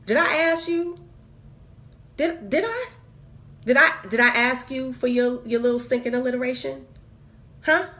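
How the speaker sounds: sassy mock confrontation of someone absent; indignant retort. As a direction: demand it with rising indignation.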